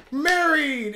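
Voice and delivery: hammed-up echo of the show's shout; excited mock cry. Fan replaying the anime's wedding moment, mock excited cry. Echoing the anime's marriage scene.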